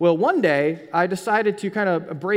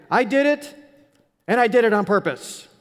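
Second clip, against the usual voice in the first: blurted confession; 5 semitones higher, 7 dB louder than usual. Retold or imitated. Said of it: "And I go".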